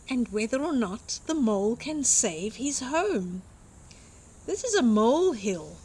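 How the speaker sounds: usual speaking voice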